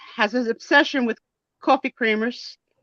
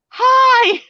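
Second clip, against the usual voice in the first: mock greeting; 11 semitones higher, 5 dB louder than usual. Imitating Jen leaning in to greet.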